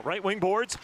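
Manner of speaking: ordinary talk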